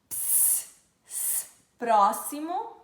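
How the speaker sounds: hissed "s", then slow "próximo"; X sounded as S. Shown as a demonstration. Teacher showing X as S, hiss before "próximo". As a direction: hiss first, say "próximo" as PRO-see-mo. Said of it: "tem o som de S".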